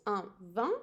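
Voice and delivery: "vin" with a nasal vowel, air through the nose; teacher modelling the nasal vowel in "vin". Shown as a demonstration. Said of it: "and again, with a nose".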